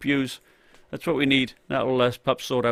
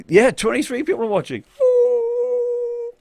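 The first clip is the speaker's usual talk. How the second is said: excited; 3 dB louder, slower than usual. Real, not acted. Excited at viewer count.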